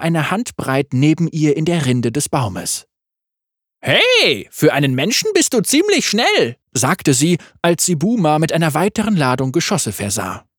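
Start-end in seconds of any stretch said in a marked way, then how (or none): 3.8-6.6 high, cheeky yordle voice; playful little gunner; Tristana voice. A narrator's reading.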